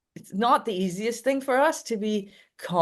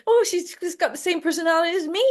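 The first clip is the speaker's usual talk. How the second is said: a much higher, doting voice for the owner; proud dog owner; 11 semitones higher, faster than usual. Retold or imitated. The owner bragging to the partygoers, doting and high. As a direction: play the smitten owner bragging about his dog. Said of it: "And he says".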